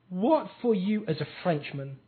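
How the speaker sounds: ordinary talk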